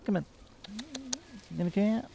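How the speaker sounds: high coaxing pet talk; cat voice. Real, not acted. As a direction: sweetly coax him out. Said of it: "my cat voice".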